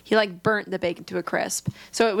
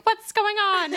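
high, mock-worried friend; mock girly voice; 10 semitones higher, slower than usual. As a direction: squeak it like a confused friend.

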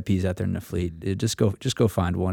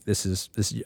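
ordinary talk, nothing marked

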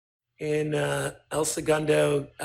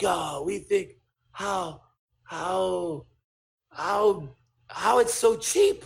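put-on Japanese accent; 2 semitones higher, much slower than usual. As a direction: puzzle it out haltingly in an imitated Japanese accent. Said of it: "here's my Japanese accent".

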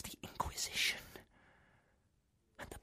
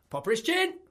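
mimicked shout; 7 semitones higher than usual. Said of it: "First he shouted".

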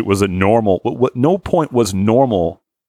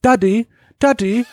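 put-on high, sweet little boy asking to tinkle; mock child whining for daddy; little kid voice. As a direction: play a small child calling for daddy. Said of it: "He's like".

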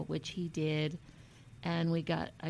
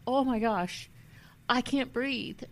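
higher, louder, reliving the panic of not breathing; panicked gasp; 5 semitones higher, 4 dB louder than usual. Retold or imitated. Retelling the moment she got the diagnosis in her car, panicked and higher. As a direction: retell it as if the air won't come. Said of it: "I just kept inhaling and just like".

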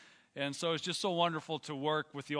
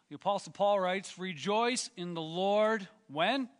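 preaching; 3 semitones higher, 4 dB louder, slower than usual. Acted. Call and response prompt.

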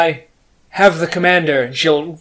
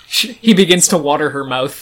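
laughing; 2 semitones higher, 4 dB louder than usual. Real, not acted. Laughing at the tentacle watering.

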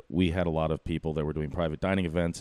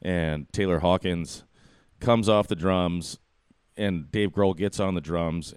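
ordinary talk

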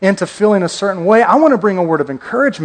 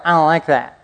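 put-on whiny kid; 5 semitones lower than usual. Kids refusing unknown food.

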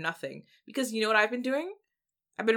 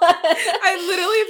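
high, loud laughing words; 10 semitones higher, 11 dB louder than usual. Real, not acted. Friend laughing about listening to the song, laughing. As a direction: laugh through the line.

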